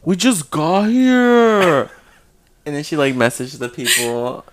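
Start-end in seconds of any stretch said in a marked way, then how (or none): 0.0-1.9 slow, higher, louder, dragged-out disbelief; shocked gasp. Retold or imitated. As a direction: stretch it out like you can't believe it.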